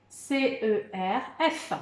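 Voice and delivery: slow, each letter said apart; spelled letter by letter. Shown as a demonstration. Teacher dictating the letters of cerf, slow and clear. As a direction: spell it slowly so they can write. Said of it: "On y va pour les lettres".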